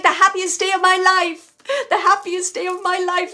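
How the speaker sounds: high, loud, elated quote; emphatic relayed joy. Retold or imitated. Medium relaying a grandfather's happiest day, elated and loud. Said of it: "He's saying it just like that".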